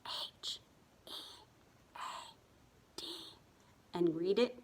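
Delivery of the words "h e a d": hushed whispered spelling, letter by letter. Shown as a demonstration. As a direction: whisper each letter separately. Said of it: "let's whisper spell it".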